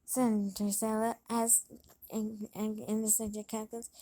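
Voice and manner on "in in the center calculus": ordinary delivery, nothing marked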